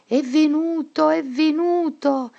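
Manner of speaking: high, loud, panicked cry; crazed shout. A narrator's reading. Teacher gone mad fleeing the classroom, high panicked shout. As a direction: shriek it like someone losing her mind. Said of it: "e gridò".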